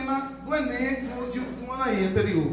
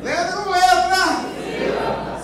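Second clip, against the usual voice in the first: loud, high and drawn out; emphatic drill call; 7 semitones higher, 6 dB louder, much slower than usual. Shown as a demonstration. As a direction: stretch it out, calling to the class. Restarting the class drill emphatically. (chamada forte e arrastada de professor)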